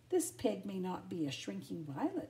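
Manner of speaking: ordinary delivery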